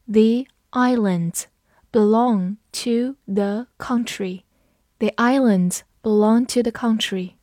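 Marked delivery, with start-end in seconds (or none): 0.1-4.4 slow, careful reading of the sentence. Shown as a demonstration. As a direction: read each word slowly and clearly. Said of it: "我们慢读一遍".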